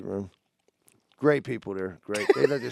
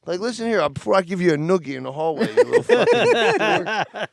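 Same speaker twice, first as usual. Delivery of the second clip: mock threat; 5 semitones higher, 6 dB louder than usual. Mock scolding the young doctor.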